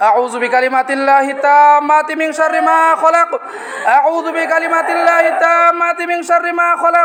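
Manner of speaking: sustained chant on a level tone; Quranic healing recitation. Real, not acted. Healer recites protective prayer. (ঝাড়ফুঁকের সুরে দোয়া পাঠ)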